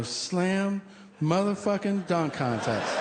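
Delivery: slow and high, every word hammered; proud emphatic repeat. Retold or imitated. Patient repeating it to the surgeon, slow and proud. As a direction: drag it out with pride.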